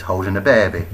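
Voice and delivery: ordinary delivery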